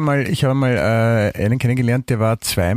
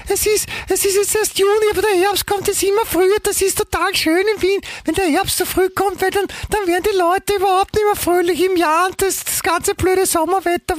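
put-on squeaky little child, very high pitched; high childlike voice. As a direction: talk up high like a little kid. Plays a high childlike figure.

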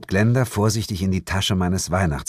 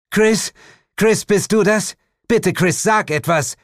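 much higher, louder pleading call; desperate calling; 13 semitones higher, 6 dB louder than usual. Acted. A woman calls desperately for her friend, loud and high. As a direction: call out frantically for someone lost.

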